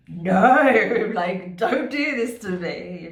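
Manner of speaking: drawn-out, loud "no" dissolving into laughter; laughing mock plea. Viewer begging the returning person off, laughing mock protest. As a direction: plead in mock dismay while laughing.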